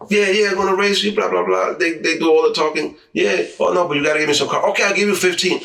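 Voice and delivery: mock boastful racer. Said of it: "He goes".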